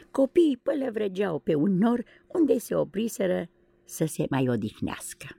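ordinary delivery

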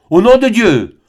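thundering friar, first cry. A narrator's reading. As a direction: bellow it like a preacher's curse. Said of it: "d'une voix tonnante".

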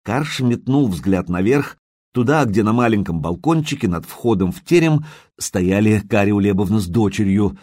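ordinary delivery, nothing marked

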